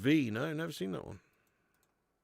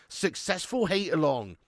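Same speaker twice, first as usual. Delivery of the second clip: ordinary delivery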